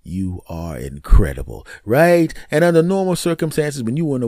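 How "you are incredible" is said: low, voiced murmur into her ear; retold intimate whisper. Man to his lover in her ear, low and intimate. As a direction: say it deep and intimate, without really whispering. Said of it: "I whispered in her ear".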